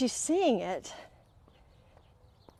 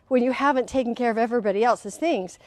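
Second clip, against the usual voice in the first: usual speaking voice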